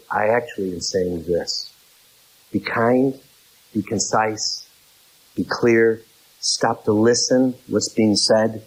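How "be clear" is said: normal talking voice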